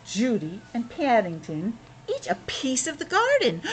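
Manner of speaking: steady read-aloud storytelling voice; story narration. Acted. Parent reading Paddington to a child, storytelling voice.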